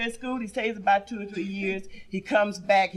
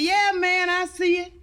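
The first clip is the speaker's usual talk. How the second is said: wino voice; 6 semitones higher than usual. Acted. Wino dialogue.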